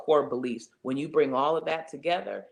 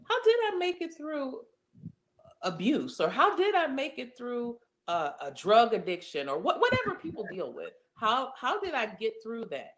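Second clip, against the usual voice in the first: high-pitched voice of someone marvelling; self-questioning wonder; 9 semitones higher than usual. Acted. Speaker voicing a listener looking back, amazed and questioning. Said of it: "think critically and say".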